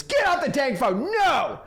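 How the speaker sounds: high, loud put-on yell; a disturbed TV watcher shooing off a caller; mock angry shout. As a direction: bark them off the phone in a comic voice. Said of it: "what they wanna do first and foremost is".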